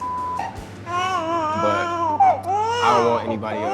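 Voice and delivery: high, sobbing through the words; crying while proposing. Real, not acted.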